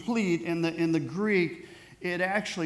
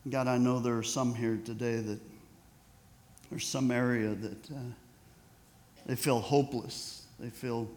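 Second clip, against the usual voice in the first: prayer; 3 semitones lower than usual. Acted. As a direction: pray it low and sincere for the congregation. Pastor leads closing prayer.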